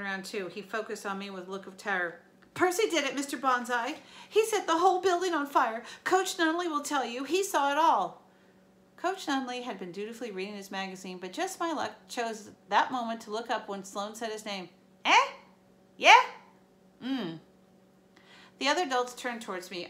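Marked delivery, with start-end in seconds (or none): 2.5-8.2 high, loud frantic tattling; terrified bully accusing; panicked accusing kid. A narrator's reading. 15.0-17.4 a few mumbled grunts; startled coach mumbling; distracted coach. A narrator's reading.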